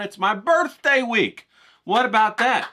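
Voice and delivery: high, loud and slow, bursting with glee; excited. Real, not acted. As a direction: announce it like big news. Announcing his birthday week.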